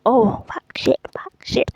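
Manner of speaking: muttered swearing under the breath; whispered cursing. Retold or imitated. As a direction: curse to yourself in a whisper. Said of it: "groaning and cursing the entire time".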